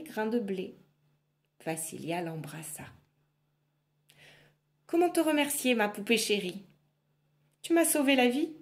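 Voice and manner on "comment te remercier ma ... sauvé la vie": higher, bright thanks; lightly voiced grateful girl. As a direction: gush with relief.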